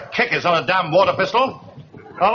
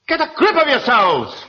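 normal talking voice

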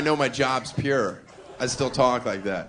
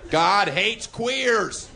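very slow, higher chanted protest slogan; 6 semitones higher, much slower than usual. Pretend. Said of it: "That's me pretending to be a right-wing redneck radical protesting".

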